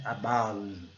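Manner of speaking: low "Above" with a light first uh; barely touch the A of "Above"; lighter schwa. Shown as a demonstration. Teacher demonstrates the schwa, light careful pronunciation. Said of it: "é um som mais leve".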